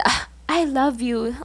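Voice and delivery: softly voiced mock-tender "Ah, I love you" from the crush; crush's sweet line. Crush tosses the gift then says it, lightly mock-tender. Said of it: "he said".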